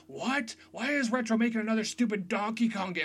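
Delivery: high-pitched imitation of griping fans; mock internet outrage. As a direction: mimic an incredulous online complainer. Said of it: "went crazy".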